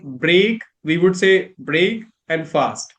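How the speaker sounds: "break" and "fast" as two separate full words; slow, split-up "break and fast"; every part stressed. Shown as a demonstration. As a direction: give BREAK and FAST equal weight. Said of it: "put focus on every single part".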